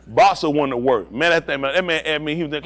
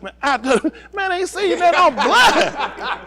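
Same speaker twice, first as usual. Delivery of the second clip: high-pitched comic protest; frantic denial; 13 semitones higher, slower than usual. Retold or imitated. Comic refuses to be a witness, high frantic denial. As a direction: deny it high up in the voice.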